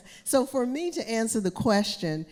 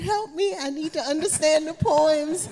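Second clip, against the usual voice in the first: higher, louder hammed-up cry for help; mock plea; 8 semitones higher, 6 dB louder than usual. Gardener retelling her call to family, hammed-up plea. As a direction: play up the desperate call for help.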